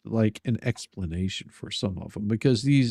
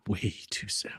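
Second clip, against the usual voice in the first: whisper; 2 semitones lower, 9 dB quieter than usual. Acted. Joking whisper aside.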